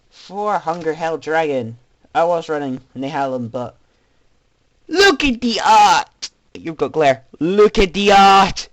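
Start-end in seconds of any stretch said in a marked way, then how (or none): 4.9-6.3 loud, slow, delighted cry; excited shout. Real, not acted. Gushing over favourite card art. 7.4-8.7 loud, slow, delighted cry; excited shout. Real, not acted. Gushing over favourite card art.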